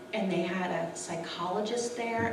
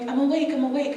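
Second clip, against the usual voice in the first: startled; 6 semitones higher, 6 dB louder, faster than usual. Retold or imitated. Startled answer to nurse.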